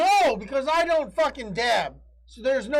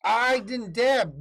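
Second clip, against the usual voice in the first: slow insistent; 2 semitones higher, much slower than usual. Pretend.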